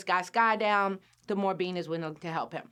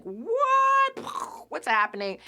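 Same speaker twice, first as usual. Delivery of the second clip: very high, loud What then a blurt; shocked squeal; 8 semitones higher, 3 dB louder, much slower than usual. Retold or imitated. Bean arriving in a strange land, squealing in shock. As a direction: gasp at a strange new land. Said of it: "she's just like".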